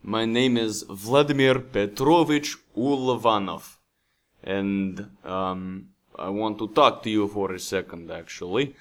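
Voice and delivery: hammed-up Russian accent; fake Russian accent. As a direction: play a Russian guy introducing himself, thick Russian accent. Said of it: "do I need to work on my Russian accent".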